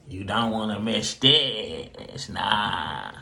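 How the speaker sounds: a hushed, knowing aside to viewers; soft teasing echo. Acted. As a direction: let the repeat trail off low and teasing. Host repeats teaser, drawn out.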